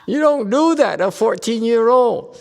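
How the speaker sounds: high, loud, laughing through it; laughing protest. Real, not acted. Teacher laughing at a student's kiss-it-better example, high and loud. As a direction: laugh it off loudly.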